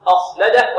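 أخلده with the first a dark and heavy like the kha; loud, over-heavy start on أخلده; thickened hamza. Shown as a demonstration. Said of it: "لا نقول".

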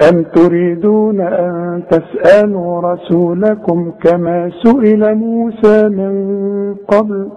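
slow melodic tajweed chant; Quran recitation. Acted. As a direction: recite slowly with held vowels.

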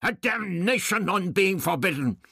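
put-on high old man bursting out in rage; explosive rage. A narrator's reading. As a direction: explode at the guard. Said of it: "the doctor exploded".

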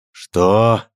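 loud startled cry; shocked exclamation. Acted. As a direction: blurt it out in shock. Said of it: "воскликнул Радгар".

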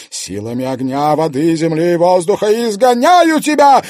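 high, loud ritual cry; shouting incantation. Acted. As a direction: cast the exorcism at full voice. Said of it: "крикнула Кадиша".

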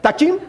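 "Dakjin" pitched high with a questioning rise; checking pronunciation. Shown as a demonstration. Speaker asking the congregation if it's right, questioning "Dakjin?". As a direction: try "Dakjin" out as if asking for approval. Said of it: "Am I saying it right?".

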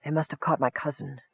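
soft, drained elf voice; hushed, gathering breath to go on; weakly continuing. A narrator's reading. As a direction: speak quietly, as if exhausted. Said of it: "took several deep breaths, then continued".

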